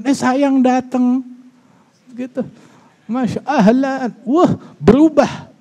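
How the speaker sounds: high, slow, sugary wife's greeting; sweet wife. Retold or imitated. Preacher voicing the changed wife welcoming her husband, high and slow. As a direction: coo it like a suddenly gentle wife.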